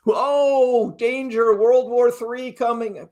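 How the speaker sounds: high-pitched fake danger warning; mock alarmist headline. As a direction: ham up the doom, pitched high and stretched out. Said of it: "the title of this live stream is not".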